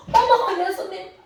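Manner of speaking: high startled gasp, then hushed; startled surprise. Acted. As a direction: act out being startled, voice dropping away. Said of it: "깜짝 놀랐죠".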